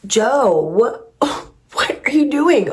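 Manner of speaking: high, incredulous laugh-through question; amused shock at the video; laughing disbelief. Real, not acted. Laughing disbelief at arrest clip.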